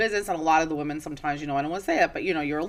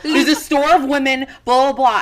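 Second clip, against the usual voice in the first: loud, higher mock order to get out; Russian attitude order; 6 semitones higher, 8 dB louder than usual. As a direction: play the fierce seamstress throwing him out, loud. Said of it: "with her little Russian attitude".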